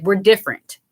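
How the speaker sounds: usual speaking voice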